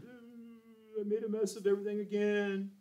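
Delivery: high, soft, drawn-out whine; mock whimpering. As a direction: imitate yourself whimpering in self-pity. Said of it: "I'm crying and whimpering".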